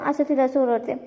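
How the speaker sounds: normal talking voice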